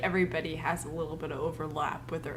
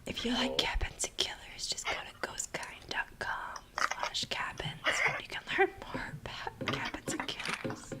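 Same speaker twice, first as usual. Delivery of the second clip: ASMR whisper; 3 semitones lower, 5 dB quieter than usual. Acted. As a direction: whisper the plug gently.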